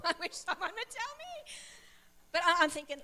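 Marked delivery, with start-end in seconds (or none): 0.0-1.5 a helpless giggle mid-thought; laughing helplessly. Retold or imitated. Pastor's wife, stuck, laughs at herself while praying. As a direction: laugh through it, a bit higher.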